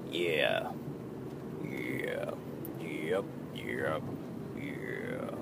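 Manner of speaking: barely audible, voice gone; voice fading out. Real, not acted. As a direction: voice cracking into whisper. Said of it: "my voice fucking went".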